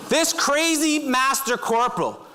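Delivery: ordinary talk, nothing marked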